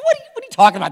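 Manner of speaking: high, loud burst of disbelief; mock indignation. Dad retelling his retort to his daughter, mock indignant. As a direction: act out an incredulous dad's protest.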